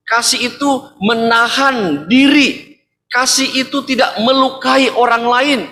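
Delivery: ringing sermon recital; preaching. Acted. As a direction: proclaim each line of the list.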